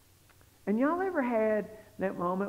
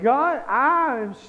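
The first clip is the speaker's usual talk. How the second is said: loud, slow, higher plea; retold tearful apology; 5 semitones higher, 6 dB louder, slower than usual. Preacher retelling his prayer while driving home, loud tearful apology. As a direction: act out breaking down as you apologize. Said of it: "I just break out crying".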